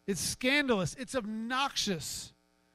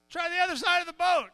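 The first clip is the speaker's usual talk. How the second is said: wise guy; 8 semitones higher than usual. Acted. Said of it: "wise guy on the shore says".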